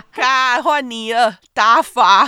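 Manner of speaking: 打罚 said loud and high, teasing; mock the misread 打罚 loudly; teasing mock pronunciation.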